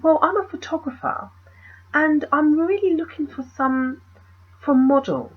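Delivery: lightly imitated well-spoken man, high pitched; high, mock-eloquent pitch to a stranger; intellectual photographer. Photographer pitching to a possible model, imitated intellectual voice. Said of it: "He spoke really intellectually".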